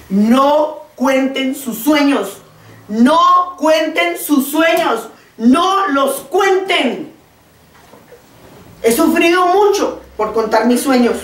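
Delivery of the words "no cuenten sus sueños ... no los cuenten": NO stretched out slowly, each word weighted; preacher to congregation, slow, weighted warning about dreams; slow emphatic warning. Shown as a demonstration. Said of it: "estoy para decirles aquí".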